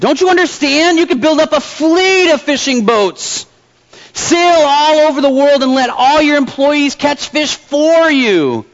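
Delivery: ticked-off businessman, high and drawn out; exasperated storybook rant, stretching the words; getting angry. A narrator's reading. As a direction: spell it out slowly in high-pitched exasperation. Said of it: "started to get a little angry".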